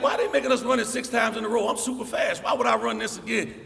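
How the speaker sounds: high, loud indignant whine; mock the arrogant freshman; hammed-up boastful protest; cocky complaint. Cocky young self complains.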